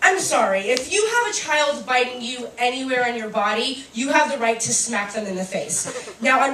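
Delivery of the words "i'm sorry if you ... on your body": ordinary talk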